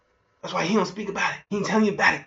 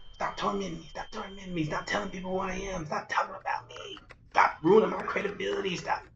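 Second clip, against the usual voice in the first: mock pleading; 7 dB quieter than usual. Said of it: "And he's crying".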